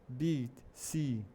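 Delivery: "B, C" with drawn-out ee; long vowel. Shown as a demonstration. Teacher modelling the first vowel, long ee in "B, C". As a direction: stretch the ee in "B, C". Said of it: "ele é um som mais alongado".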